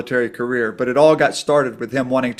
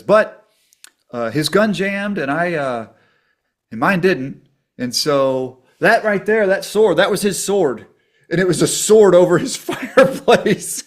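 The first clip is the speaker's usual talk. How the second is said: higher and louder, rushing into the story with a laugh at the end; excited retelling; 5 semitones higher, 5 dB louder than usual. Retold or imitated. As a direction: relive the war tale, voice climbing, a laugh breaking through at the end. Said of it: "And he says".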